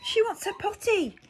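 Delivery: high, loud urgent cry. Acted. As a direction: sound the alarm. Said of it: "cried the general".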